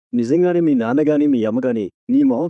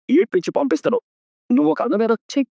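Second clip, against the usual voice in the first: high angry outburst; 13 semitones higher than usual. Acted. Heated quarrel continues.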